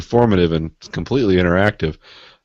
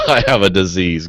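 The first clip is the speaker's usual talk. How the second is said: laughing; 3 dB louder than usual. Real, not acted. Laughing while mock-confessing.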